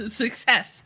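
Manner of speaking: ordinary delivery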